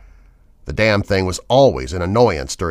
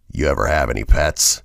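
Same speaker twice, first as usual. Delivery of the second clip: menacing trucker; 6 semitones lower than usual. A narrator's reading. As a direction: savor the danger as a threat. Villain voiced in story.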